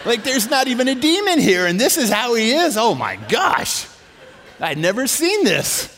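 high, loud comic dismay; aghast disbelief. Retold or imitated. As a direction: cry out, utterly dumbfounded. Aghast realisation, no demon.

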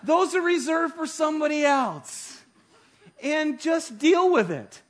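half laughing, half crying at the memory. Real, not acted. Laughing at his own story.

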